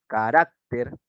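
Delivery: carácter said slowly, stressing RAC; stress on second-last syllable. Shown as a demonstration. Teacher showing students a grave word, carácter stressed on RAC. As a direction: draw out carácter with the weight on its middle syllable. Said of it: "me piden palabras graves".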